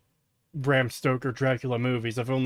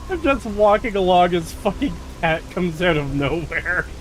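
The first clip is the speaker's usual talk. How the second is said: laughing; 4 semitones higher than usual. Real, not acted. Laughing retelling the scare.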